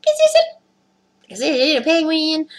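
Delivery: high, playful questioning voice; silly put-on voice. Joking about the shirt, silly high voice. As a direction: ask it in a silly high voice, drawn out.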